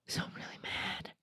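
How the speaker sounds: almost whispered admission; hushed aside. Real, not acted. Admitting her anger to the listener, hushed.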